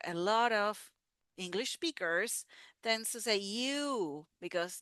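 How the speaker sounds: ordinary delivery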